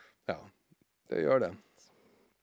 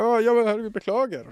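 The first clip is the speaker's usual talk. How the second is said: high, louder flustered apology; 9 semitones higher, 6 dB louder than usual. Retold or imitated. Stressed taxi driver apologising to his passenger, flustered. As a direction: blurt a stressed apology in a retold quote.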